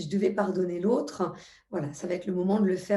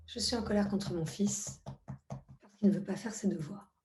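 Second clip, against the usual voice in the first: soft tapping phrase; 5 dB quieter than usual. Shown as a demonstration. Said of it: "On va dire".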